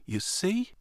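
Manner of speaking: gentle knowing whisper; soft whisper with a lift. A narrator's reading. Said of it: "the angel whispered".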